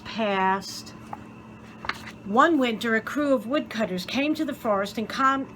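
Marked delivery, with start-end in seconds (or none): none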